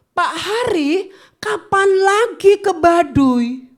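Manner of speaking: slow, high, sugary request; butter him up; mock-sweet mother asking; sweet eager asking.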